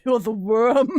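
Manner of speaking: hammed-up villain, giggling through it; mock villain scorn. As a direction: sneer down at a worm. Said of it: "you're like".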